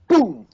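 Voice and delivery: loud, high burst of a boom; sudden boom. Retold or imitated. Telling how his brother fell through lake ice, loud boom noise. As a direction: blurt the crash as the ice gives way.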